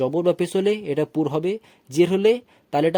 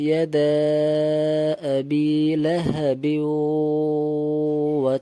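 slow, measured chanting of the verse; Quran recitation; much slower than usual. Shown as a demonstration. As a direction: recite the whole verse slowly and melodically. Teacher reads the whole verse.

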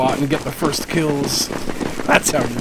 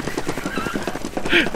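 burst of laughter; 3 dB quieter than usual. Real, not acted. Laughs at teammate.

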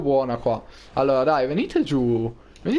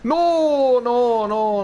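alarmed shout; 6 semitones higher, much slower than usual. Real, not acted. Car about to crash.

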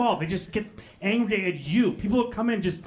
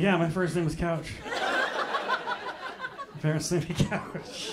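sarcastic; 4 dB quieter, slower than usual. Retold or imitated. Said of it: "I'm like".

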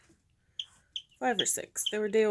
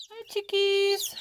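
talking to chickens; 8 semitones higher, 5 dB louder, slower than usual. Real, not acted. Baby talk to chickens.

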